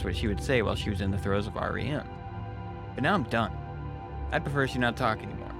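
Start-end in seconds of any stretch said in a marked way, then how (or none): none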